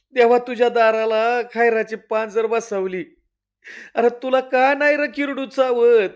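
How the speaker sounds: high, loud wailing at god; wailing lament. Acted. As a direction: cry out to god in grief.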